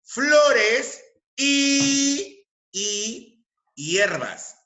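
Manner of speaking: "y" held very long, high and loud; stress on "y". Shown as a demonstration. Teacher warning students about the exception, drawn-out emphatic "y". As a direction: stretch out "y" before "hierbas". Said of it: "atención".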